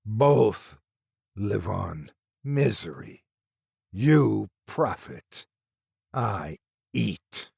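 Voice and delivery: slow broken monster speech; a rasping thing taunting; lightly voiced creature, halting. A narrator's reading. Monster speaks in story.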